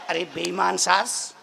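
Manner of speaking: ordinary talk, nothing marked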